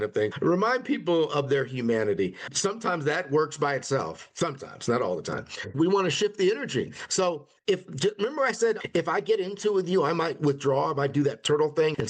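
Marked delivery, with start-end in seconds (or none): none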